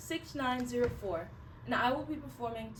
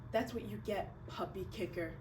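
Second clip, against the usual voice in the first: quiet self-condemnation; 4 semitones lower, 8 dB quieter than usual. Acted. Remembered thought while gun is aimed.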